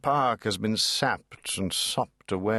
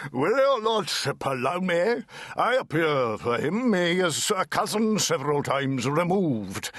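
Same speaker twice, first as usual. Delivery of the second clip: booming little counsel; small lawyer with a big voice, lightly done; terrific bass voice; 4 semitones higher than usual. Acted. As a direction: fill the rafters with a big voice. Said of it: "a terrific bass voice".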